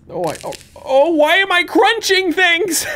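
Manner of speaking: panicked. Real, not acted. Startled by crunching noises.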